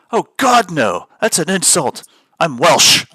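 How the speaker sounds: high, loud mock outrage at being called British; offended Welsh gamer. Retelling a Welsh gamer's outburst, mock outraged. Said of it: "He's like".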